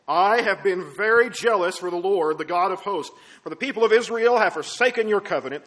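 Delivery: loud, worked-up prophet pleading his case; frustrated outburst. Acted. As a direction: declaim the grievance with full conviction. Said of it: "really in a state of frustration".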